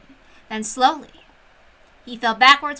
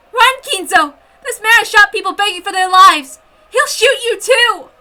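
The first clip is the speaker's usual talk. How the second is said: frantic warning scream; 8 semitones higher, 10 dB louder than usual. Acted. Captive woman warning Kinzo to flee, frantic scream.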